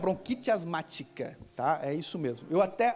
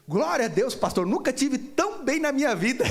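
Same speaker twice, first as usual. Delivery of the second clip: higher-pitched, elated voice of the healed woman; imitated joyful amazement; 4 semitones higher, 3 dB louder than usual. Healed woman telling her pastor she feels well, imitated elation. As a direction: retell her testimony with rising excitement.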